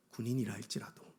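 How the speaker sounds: whispery drop in volume; sudden hush. Real, not acted. Preacher lands the climax about huge enemy soldiers, suddenly hushed. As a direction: pull right back to a near whisper.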